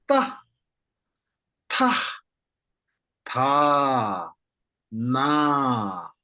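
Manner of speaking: Ta Ta Ta then Na, drawn out; slow drill of Ta then Na; syllable drill. Shown as a demonstration. Teacher to class, drilling each sound slowly. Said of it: "I will read them one by one".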